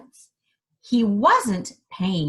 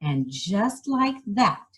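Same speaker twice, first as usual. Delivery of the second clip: usual speaking voice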